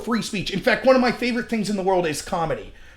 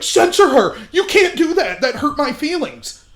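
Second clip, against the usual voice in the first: higher-pitched mocking complaint; mock outrage; 5 semitones higher than usual. Mimicking right-wingers demanding a comedian be censored, mock outrage. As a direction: imitate thin-skinned people whining in protest.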